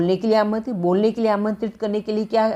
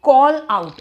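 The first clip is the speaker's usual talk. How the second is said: teacher shouting the phrase to show its meaning; loud call out; 4 semitones higher, 6 dB louder than usual. Shown as a demonstration. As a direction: call out punched louder. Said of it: "आउट शब्द से ही चिल्लाने वाली feeling आती".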